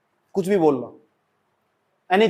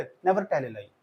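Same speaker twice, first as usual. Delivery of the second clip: lower, much softer, flat delivery; low soft aside; 7 semitones lower, 10 dB quieter, much slower than usual. Shown as a demonstration. Teacher showing students how not to say it, low and soft. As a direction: mumble it as the wrong way. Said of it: "ऐसे नहीं".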